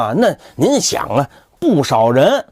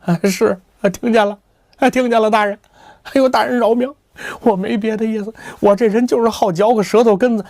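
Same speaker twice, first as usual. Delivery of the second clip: put-on nervous young man; 5 semitones higher than usual. Kou begs the official for mercy.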